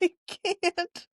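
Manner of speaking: high giggly I can't; breaking up laughing. Real, not acted. Caught fidgeting on mic, can't stop laughing.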